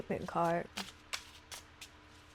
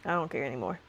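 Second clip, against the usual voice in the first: dismissive whatever; 5 semitones lower, 4 dB quieter, faster than usual. Retold or imitated. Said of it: "like, whatever energy".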